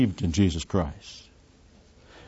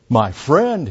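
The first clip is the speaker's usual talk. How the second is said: higher-pitched, taken aback; indignant exclamation; 6 semitones higher, 4 dB louder than usual. A narrator's reading. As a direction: throw it back in disbelief. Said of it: "Miller exclaimed".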